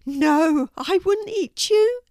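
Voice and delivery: loud, high shocked denial; high-pitched, loud cartoon cat; protesting cat character. A narrator's reading. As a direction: deny it emphatically in a high cat voice.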